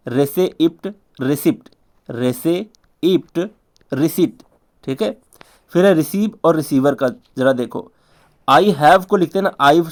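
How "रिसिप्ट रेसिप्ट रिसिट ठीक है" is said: ordinary talk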